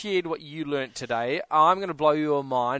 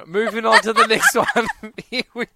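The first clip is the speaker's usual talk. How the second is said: louder, higher, laughing through the words; 4 semitones higher, 7 dB louder than usual. Real, not acted. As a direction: move things along while laughing. Laughing at a wrong guess.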